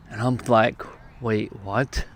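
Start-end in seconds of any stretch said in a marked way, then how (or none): 1.2-2.1 low, hushed double-take; retold stunned disbelief. Speaker hearing strangers sense his pain, quietly stunned. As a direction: replay being taken aback, softly and low.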